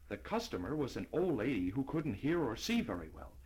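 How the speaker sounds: plain reading voice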